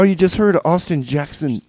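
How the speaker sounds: ordinary delivery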